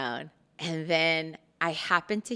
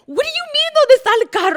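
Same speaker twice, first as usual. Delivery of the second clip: much higher and louder, baffled; incredulous high; 15 dB louder, much faster than usual. Retold or imitated. Daughter answers her father, high and incredulous. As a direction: squeal it in disbelief. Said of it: "And I'm like".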